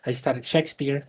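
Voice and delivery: usual speaking voice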